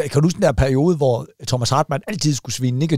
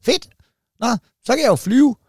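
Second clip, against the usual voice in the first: hopeful penguin; 7 semitones higher than usual. Retold or imitated. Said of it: "pingvinen ... ved Gud".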